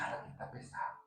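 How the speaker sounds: hushed uneasy whisper. Retold or imitated. Someone in a heavy place, whispering. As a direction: whisper it with a shiver.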